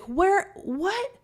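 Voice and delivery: very high, baffled questions; retold disbelief. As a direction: squeak out bewildered questions. Retold disbelief at old photo.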